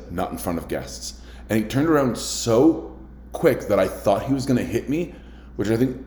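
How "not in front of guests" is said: low, hushed firm rebuke; firm quiet warning. Retold or imitated. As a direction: keep it down, guests are near. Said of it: "held my hand up".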